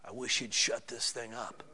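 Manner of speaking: quiet, grumbling aside; muttered mock complaint. A bored churchgoer grumbles to their spouse in a quiet mutter. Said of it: "Instead of".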